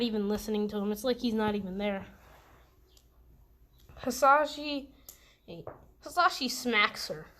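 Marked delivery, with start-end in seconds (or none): none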